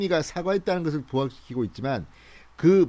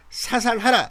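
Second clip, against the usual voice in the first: raised commanding shout; barked order; 7 semitones higher, slower than usual. Retold or imitated. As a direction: order it sharply. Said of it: "이렇게 명령을 했습니다".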